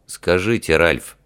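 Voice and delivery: ordinary delivery, nothing marked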